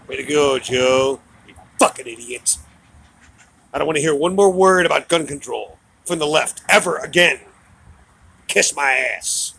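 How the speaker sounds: loud, furious yelling; angry shout. Real, not acted. Ranting at Joe and the left, a real furious shout. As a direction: blast it at him at full volume.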